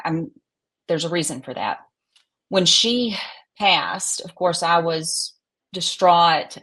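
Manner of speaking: ordinary talk, nothing marked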